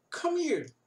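high, loud "Come here" called out; calling out. Retold or imitated. Teacher imitates the character calling the kid over, loud. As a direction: summon someone across the street. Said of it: "Aí ele continua".

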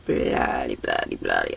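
very low, quiet filler mumble; low muttered placeholder words; idle muttering. Real, not acted. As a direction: mumble it under your breath, pitch dropped right down. Idle filler while finding the place.

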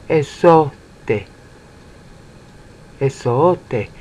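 Esote with the O sliding up toward the roof of the mouth; Esote, O rising like Mandarin second tone; rising Greek accent. Shown as a demonstration. Teacher shows students the rising accent on Esote. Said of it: "See that little mark that's going up?".

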